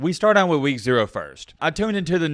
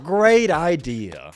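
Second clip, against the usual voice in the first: slow, dry sarcastic drawl; much slower than usual. Acted. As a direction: drag it out, deadpan mockery. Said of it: "I'm being sarcastic".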